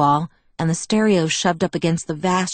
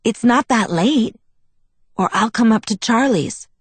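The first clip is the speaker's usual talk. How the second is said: higher, hopeful urging; eager enthusiasm; 5 semitones higher than usual. A narrator's reading. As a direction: keep pressing the invitation eagerly. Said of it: "he said with sudden enthusiasm".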